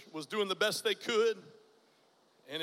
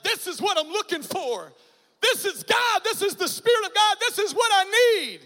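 much higher and louder, near shouting with joy; elated; 9 semitones higher, 7 dB louder than usual. Retold or imitated. Young man finds the church he was looking for, ecstatic cry. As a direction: ring it out like a revelation.

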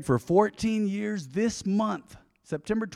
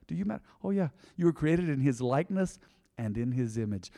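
ordinary talk

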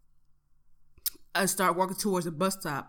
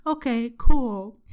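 slow, louder breezy okay cool; professor's casual reply; 3 semitones higher, 7 dB louder, much slower than usual. Retold or imitated. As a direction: drawl it like an unbothered teacher. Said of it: "She's like".

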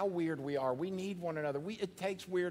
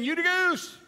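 high, laughing goose cheer; 13 semitones higher, 8 dB louder than usual. Pretend. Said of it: "just know I'm saying".

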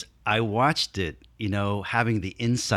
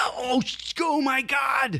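panicked shout; slower than usual. Retold or imitated. Retelling the injury panic.

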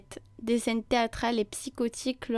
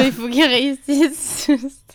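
loud teasing repeat of the quote; mocking loud echo; 3 semitones higher, 8 dB louder, slower than usual. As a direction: throw the line back, laughing. Echoes the quote mockingly, laughing.

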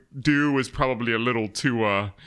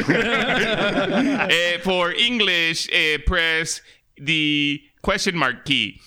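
slow phone-menu prompt in mock Mexican accent; caricatured Mexican accent; 3 semitones higher, much slower than usual. Phone menu instructing callers which key to press, put-on Mexican accent. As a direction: play an automated menu with a comic Mexican accent. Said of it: "I'll do my beaner voice".

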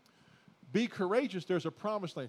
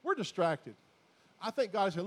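ordinary talk, nothing marked

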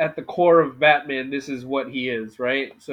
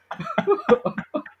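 high laugh. Real, not acted. Laughing through a voice bit.

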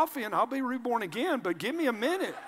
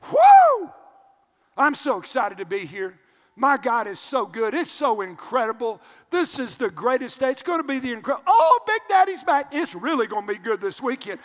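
mock excited churchgoer; 3 semitones higher, 8 dB louder than usual. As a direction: play the overjoyed worshipper bursting in. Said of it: "Just walk in saying".

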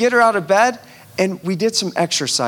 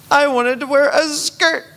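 put-on whiny child; 9 semitones higher, 4 dB louder than usual. Penny's complaints.

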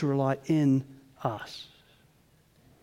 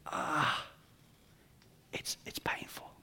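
wince, then whisper it; a breathy, aching aside; pained whisper; 3 semitones lower, 14 dB quieter, slower than usual. Retold or imitated. A listener cringing at Israel's grumbling, in a pained whisper. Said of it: "you're just like".

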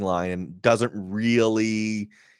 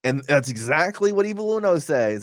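ordinary delivery

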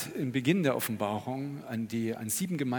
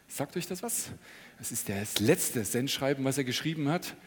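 normal talking voice

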